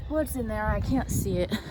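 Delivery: ordinary talk, nothing marked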